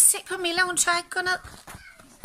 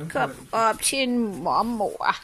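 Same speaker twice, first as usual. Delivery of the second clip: slow, lower granny-style coo; doggy baby talk; 5 semitones lower, much slower than usual. Acted. Owner calls the dog up to granny, slow coo. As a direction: coax the dog up like a doting granny.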